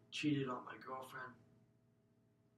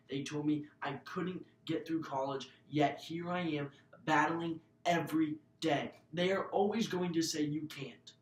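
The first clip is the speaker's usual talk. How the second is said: building from firm to loud and higher; rising defiance; 3 semitones higher, 10 dB louder than usual. Real, not acted. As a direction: swell into a defiant call to the crowd. Speech builds to climax.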